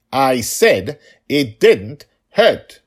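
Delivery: stubborn, each word pressed out; defiant insistence. Acted. Daughter throwing his words back at her father, slow and loud.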